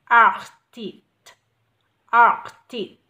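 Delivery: "Artist" very loud on a long opening vowel. Shown as a demonstration. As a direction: punch and lengthen the "ar" of "Artist". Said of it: "cũng có ai dài này". ("Artist" đọc thật to, kéo dài âm a)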